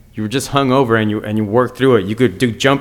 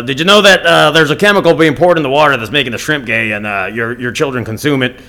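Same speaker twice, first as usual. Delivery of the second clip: a lightly voiced, louder conspiracy-radio rant; mock talk-radio alarm, pushed a little louder; Alex Jones impression; 2 semitones higher, 5 dB louder than usual. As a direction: play a breathless conspiracy host warning the audience. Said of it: "a little bit of Alex Jones".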